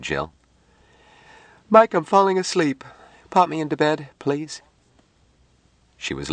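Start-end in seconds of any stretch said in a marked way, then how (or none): 1.7-4.6 much higher, drowsy character voice; high-voiced plea from someone drifting off; sleepy woman character. A narrator's reading. Jill asks Mike to pop her into bed, sleepy and high.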